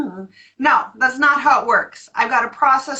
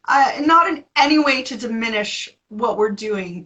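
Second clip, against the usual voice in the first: ordinary delivery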